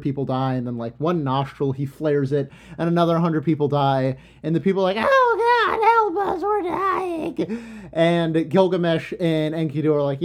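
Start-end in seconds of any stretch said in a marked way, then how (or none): 5.0-7.9 panicked pleading. Retold or imitated. Dying townsfolk plead to the gods in panic. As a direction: wail for help at the top of the voice.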